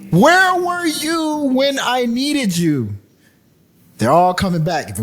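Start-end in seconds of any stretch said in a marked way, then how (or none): 0.1-3.0 very slow, high wail of reproach; mock plea. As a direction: stretch out a hammed-up, high lament. Mock plea repeated for effect.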